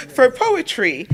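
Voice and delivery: loud laugh spilling into the words; laugh, then draw the words out through it; loud, slow, amused delivery. Real, not acted. Amused at comic books as poetry.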